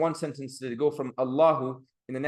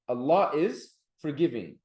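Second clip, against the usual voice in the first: ordinary delivery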